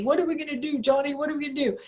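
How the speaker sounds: mock worried repetition of a helpless question; fretting worker. Boss mimicking his worker's fretting, mock panic.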